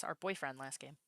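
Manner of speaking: usual speaking voice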